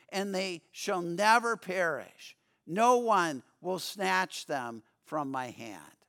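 ordinary talk

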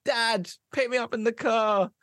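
high-pitched wheedling call to dad; pleading son. Acted. Drunk son wanting a lift home from dad, high-pitched pleading.

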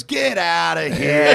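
playful get out. Retold or imitated. Shopkeeper dismissing in story.

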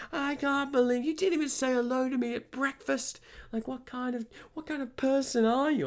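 very high imitation of an irate customer; outraged accusation. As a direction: mimic her affronted ranting.

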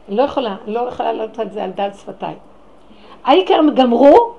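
normal talking voice

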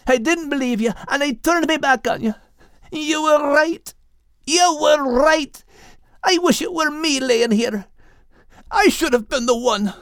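breaking with grief at the end; anguished. A narrator's reading. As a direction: plead through tears of guilt. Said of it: "Max cried out in anguish".

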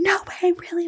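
high-pitched amazed reply; excited disbelief. Retold or imitated. Mom hearing the approval news, excited disbelief. Mom's excited reaction, retold.

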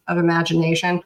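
ordinary talk, nothing marked

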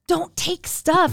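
high-pitched, scandalised scolding; freaked out. Retold or imitated. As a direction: imitate a mom panicking at rule-breaking. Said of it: "get so freaked out".

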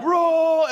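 high held "Rural" as one syllable, roll; mimicking rural. Husband echoing wife in the car, high "Rural". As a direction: echo a stretched, high-pitched "Rural". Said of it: "And I'm like".

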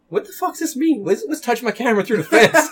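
high, baffled mock voice; confused Garth. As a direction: play Garth reading it totally lost. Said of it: "he's just like".

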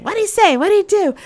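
high, loud urgent questions; frantic mom. Retold or imitated. Mom grilling her daughter, high loud urgency. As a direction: fire them off like a worried mother. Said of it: "Mom's like".

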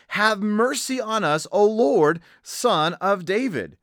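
crying out. Acted. Two blind men calling to Jesus from the roadside, crying out. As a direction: call out slowly for mercy. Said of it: "and they cried out".

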